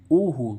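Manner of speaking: ओ हो said loud, with the ओ held; emphatic rule. Shown as a demonstration. Teacher stressing the ending ओ for the class. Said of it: "इस ओ का उच्चारण ओ होता है".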